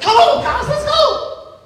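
high, excited urging; eager young David. Acted. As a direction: rush the others along like a thrilled kid. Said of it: "David's like".

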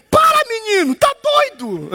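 high shocked scold; mother scolding her joking son. Retold or imitated. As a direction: snap at a cheeky son. Mother scolds him in story.

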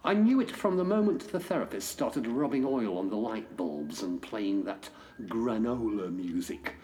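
normal talking voice

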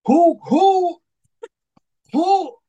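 high, loud disbelieving "who?"; incredulous outcry. Real, not acted. Fan outraged that the hero lost his accent, high incredulous cry. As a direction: shoot way up high, can't believe what he's hearing.

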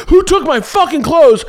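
high-pitched imitated outrage; comic mock yell, pitched very high; angry yell. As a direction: play a furious robbery victim, voice way up. Imagined man hunting stolen clothes.

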